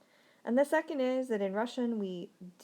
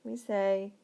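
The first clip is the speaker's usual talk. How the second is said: ordinary talk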